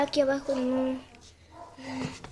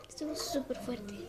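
hushed whisper; 9 dB quieter than usual. Real, not acted. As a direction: whisper it, still shaken. Quiet aside after the quake. (susurrando, todavía impresionada)

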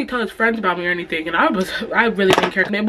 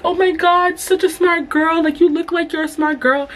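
lightly voiced doting mom; high gushing mom; 8 semitones higher than usual. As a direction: gush like an impressed mom. Mom praises her.

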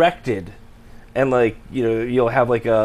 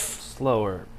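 soft, low one-word director's note; George Lucas; 5 semitones lower, 11 dB quieter than usual. Retold or imitated. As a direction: mumble a minimal note, imitating a director. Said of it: "pretty good impression of George Lucas".

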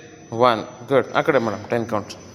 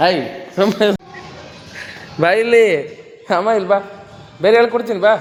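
calling out; 7 semitones higher than usual. Real, not acted. Calling child to come over.

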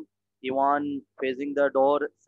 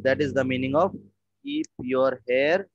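normal talking voice

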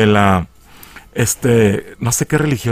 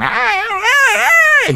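high shrieking wails of pain; horrible screaming; much slower than usual. Retold or imitated. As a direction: shriek like a possessed girl. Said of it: "Y gritan así horrible". (gritos agudos de dolor)

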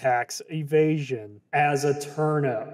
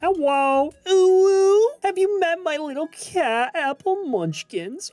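very high, sweet chirpy voice; squeaky cranberry; 12 semitones higher, 3 dB louder than usual. Acted. Gamer reading the cranberry's dialogue to Turnip Boy, squeaky high. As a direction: gush like a tiny cheerful fruit.

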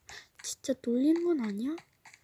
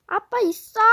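usual speaking voice

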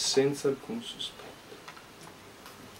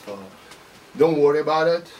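ordinary delivery

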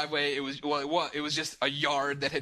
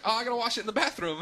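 high, quick offhand host voice; imitating the host; 7 semitones higher, faster than usual. Host explaining he washes cups in the bathroom, high and casual. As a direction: toss it off like it's normal. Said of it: "He's like".

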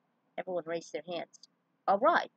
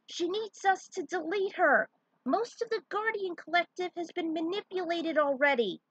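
high, raised voice over noise; high shouted explanation; 10 semitones higher, 5 dB louder than usual. Acted. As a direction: call the news out over the engines. Samus shouting over engines.

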